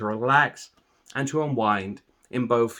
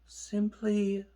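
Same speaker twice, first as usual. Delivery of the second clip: guided meditation; 2 semitones lower, 10 dB quieter than usual. Acted. Meditation teacher leading listeners through a body scan, calm and soothing. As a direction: calmly guide listeners into relaxation, unhurried and hushed.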